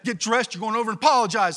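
loud, firm order from mom; a mother's loud fast command; stern mother. Retold or imitated. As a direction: lay down the law like an angry mother. Angry mother voiced in story.

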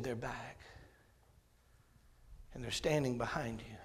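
hushed, scared whisper; frightened whisper. Retold or imitated. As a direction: breathe it out in terror. Frightened girl sees spirits.

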